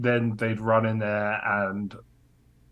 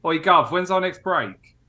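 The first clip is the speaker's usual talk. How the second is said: cheeky kitchen worker; 7 semitones higher than usual. Acted. Player addresses chef in character.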